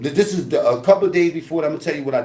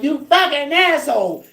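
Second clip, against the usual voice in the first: high, loud outraged yell; angry yell; 12 semitones higher, 3 dB louder than usual. Retold or imitated. Pearhead cursing the thrower after the hit, high angry yell. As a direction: curse him out furious. Hit man yells back.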